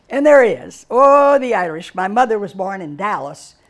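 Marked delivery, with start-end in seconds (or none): none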